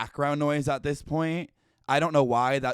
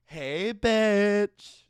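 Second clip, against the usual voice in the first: put-on cocky cool-girl greeting, higher and louder; cool Supergirl; 7 semitones higher, 5 dB louder than usual. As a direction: nail it like a swaggering teen hero. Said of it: "seventeen-year-old cool Supergirl".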